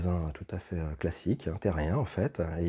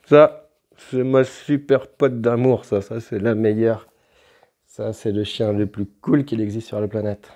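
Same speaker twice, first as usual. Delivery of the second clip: doting on the dog; 3 semitones higher, 7 dB louder, slower than usual. Real, not acted. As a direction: gush over her like a proud owner. Cooing over his dog on camera.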